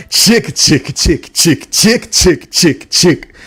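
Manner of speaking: clipped rhythmic chugging noises; imitated train chugging. Likening a boxer to a locomotive, imitated train chugging. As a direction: chug like a locomotive. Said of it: "Errol Spence is like a locomotive".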